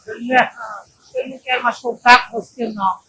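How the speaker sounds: usual speaking voice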